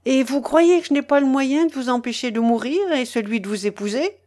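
ordinary delivery

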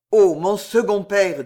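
the count kneels and prays to the abbé, solemn; solemn invocation. Acted. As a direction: call on a lost father, lightly fervent.

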